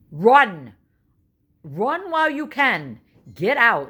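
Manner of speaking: loud, high mock-horror shout; hammed-up panic to a Florida woman; mock warning. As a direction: yell it like a horror-movie warning. Jokingly warning a stranger.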